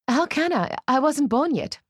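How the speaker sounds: high, distressed protest; edge of hysteria. A narrator's reading. Bride denies Ruth's memory, near hysteria. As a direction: protest in rising panic, pitched high. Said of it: "sharp metal edges of hysteria".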